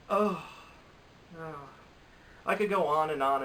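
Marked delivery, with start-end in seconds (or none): 0.1-1.5 two weary groans with a pause between; exasperated groan. Real, not acted. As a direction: groan in disgust at the snub. Groans over the snubbed movie.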